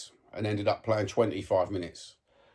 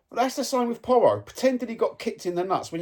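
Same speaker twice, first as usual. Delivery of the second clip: exasperated burst; 12 semitones higher than usual. Real, not acted. Fan ranting to viewers about Porro, exasperated high burst. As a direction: throw your hands up at the player.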